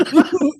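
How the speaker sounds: forced high laugh; fake laugh. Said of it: "trying to fake laugh".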